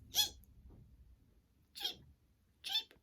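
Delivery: very high tiny cheep; high little chick peeps. Acted. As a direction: peep like a newborn chick. Baby chick sound.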